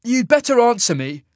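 high, loud, irritated demand; snapped. A narrator's reading. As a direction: snap it at the silent giant. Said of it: "Cole snapped".

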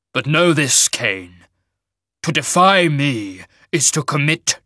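slow, loud, weighty warning; stern shaman, rage held back. Acted. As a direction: mage lord laying down the law.

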